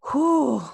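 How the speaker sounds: high, loud exhale of relief; relieved loud whew. Real, not acted. After getting through the quote, loud relieved whew. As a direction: blow out a big relieved whew. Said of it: "I made it".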